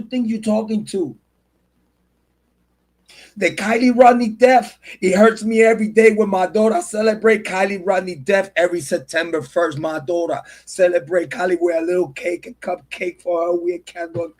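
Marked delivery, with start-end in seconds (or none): none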